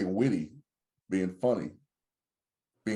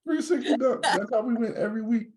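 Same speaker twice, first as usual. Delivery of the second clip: laughing brag; 12 semitones higher than usual. Real, not acted. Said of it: "laughing brag".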